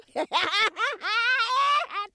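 high, loud wailing laugh-cry; wailing cackle. Acted. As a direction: let out a howling half-sob laugh.

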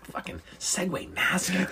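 soft, breathless whisper after a laugh; whispered aside. Real, not acted. Man whispers praise of his friend's segue.

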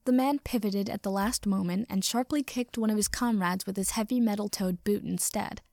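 ordinary delivery

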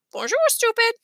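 high squawky parrot voice. A narrator's reading. Uncle Feather the parrot answering Jimmy in a squawk. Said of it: "Uncle Feather answered".

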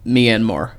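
"Myanmar" said slowly as mee-AN-mor; looked-up pronunciation. Shown as a demonstration. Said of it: "the pronunciation is".

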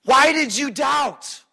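high, loud, slow rebuke; rebuking Jesus. Retold or imitated. Jesus rebuking Peter on the water, loud slow rebuke. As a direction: call him out for doubting. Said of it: "He goes".

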